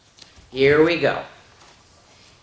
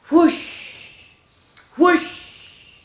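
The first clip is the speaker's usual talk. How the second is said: plane whoosh; 11 semitones higher than usual. Shown as a demonstration. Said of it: "She's off for a spin".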